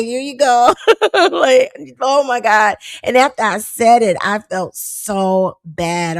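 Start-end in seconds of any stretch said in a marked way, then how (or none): 0.0-0.7 high, hurried, meek; timid high here you go; meek compliance. Retold or imitated. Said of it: "I quickly got my credentials". 0.8-2.8 laughing oh my God, pitched high; amused disbelief with a laugh. Real, not acted. Laughing at her own story.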